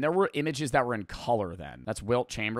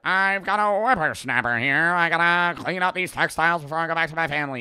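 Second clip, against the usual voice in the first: put-on loud, pitched-up old-fashioned character voice; old-timer impression; 5 semitones higher, 6 dB louder than usual. Streamer playing a man in an old photo, old-timer impression. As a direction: put on a hammy old-time man, raised voice. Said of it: "people from that time sounded like that".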